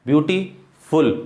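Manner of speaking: Beautiful slowly, pause before फुल; split into syllables. Shown as a demonstration. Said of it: "जब एक से ज़्यादा syllable".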